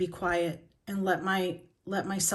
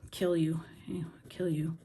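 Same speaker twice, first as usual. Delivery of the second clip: ragey whisper; 3 semitones lower, 7 dB quieter than usual. Real, not acted. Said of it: "I got so ragey".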